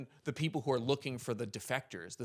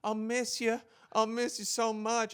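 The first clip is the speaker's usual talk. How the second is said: Southern belle; 8 semitones higher, slower than usual. Retold or imitated. Belle bids soldier farewell.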